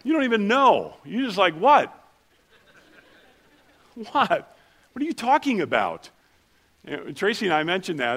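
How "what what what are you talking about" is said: mock bewildered, higher and louder; baffled what. Pastor mocking locals' confusion, mock baffled. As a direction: play the clueless local, half-joking.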